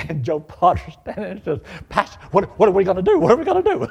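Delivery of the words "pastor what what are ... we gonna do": frantic question climbing high and loud; mock panic. Missionary asking the pastor as the gunman arrives, rising panic. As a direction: imitate a frightened man losing his head.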